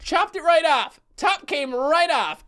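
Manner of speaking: put-on high, delighted echo of toddler self; gleeful repeat. Quoting his toddler self with delight, high and amused. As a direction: relish the childish words. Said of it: "Did you hear that?".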